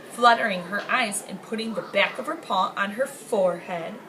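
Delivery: ordinary delivery, nothing marked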